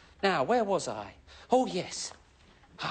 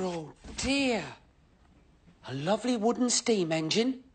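ordinary talk

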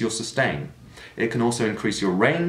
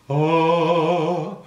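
darker held oh, higher; dark sound colour; 6 semitones higher, 3 dB louder than usual. Shown as a demonstration. Teacher shows dark sound colour. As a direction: darken the vowel. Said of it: "So we could be darker".

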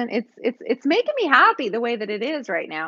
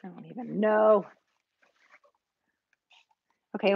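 usual speaking voice

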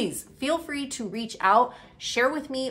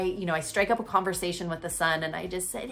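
usual speaking voice

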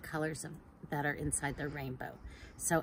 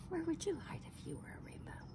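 whisper; 12 semitones higher, 9 dB quieter, much faster than usual. Real, not acted. Teacher asks the children, whispering. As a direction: whisper the question to draw them in.

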